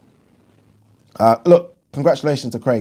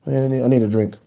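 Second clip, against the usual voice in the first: ordinary talk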